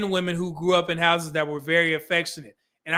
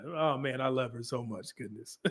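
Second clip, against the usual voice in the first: soft and much quieter, almost to himself; tender murmur; 6 semitones lower, 11 dB quieter than usual. Real, not acted. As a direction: murmur it lovingly about your wife. Tender aside about wife.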